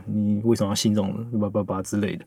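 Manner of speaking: ordinary delivery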